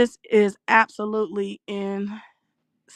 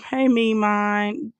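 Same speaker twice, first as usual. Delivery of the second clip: ordinary talk, nothing marked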